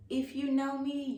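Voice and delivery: usual speaking voice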